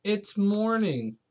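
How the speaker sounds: slow, high, small announcement; boy breaks the news to granny; little boy voice. Acted. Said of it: "said Patrick".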